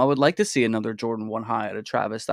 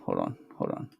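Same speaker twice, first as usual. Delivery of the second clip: muttered under breath; 10 dB quieter than usual. Real, not acted. Streamer hunting for the volume, whispering to himself. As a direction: hold on, hold on, dropped to a breathy whisper.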